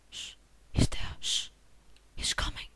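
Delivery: unvoiced whisper; secretive whisper while hiding; hushed warning. Real, not acted. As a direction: whisper it so the enemy won't hear. Hiding from enemy in game.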